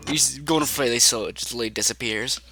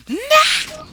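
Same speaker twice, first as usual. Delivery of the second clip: very high, loud squawk; Chica's cry; 5 dB louder than usual. Acted. Game master voices Chica hit by tree, high mock squawk. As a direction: yelp it sharply in a squeaky put-on voice.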